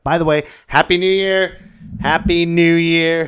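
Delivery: high, loud New Year cheer; big festive hello; cheerful greeting. Real, not acted. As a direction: shout it out to everyone.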